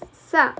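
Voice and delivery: "sa" sounded alone, an S with short vowel; soft C sound. Shown as a demonstration. Teacher showing the C in rice, soft C sound. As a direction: isolate "sa" as a phonetic demo. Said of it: "I pronounce this".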